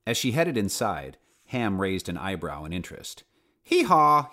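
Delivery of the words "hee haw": high, loud donkey hee-haw; a hammed-up donkey call; donkey bellow. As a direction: bray it out like a donkey. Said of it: "he bellowed".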